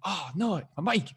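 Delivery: mock dismay. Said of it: "And I'm like".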